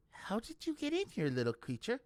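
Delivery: high, tender coaxing; gentle to animal. A narrator's reading. Brutha coaxes the tortoise, high and gentle. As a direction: talk to a small creature kindly.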